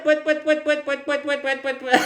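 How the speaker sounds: very high, loud pouet chant; silly clown. Shown as a demonstration. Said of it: "On y va". (clown criant pouet très aigu)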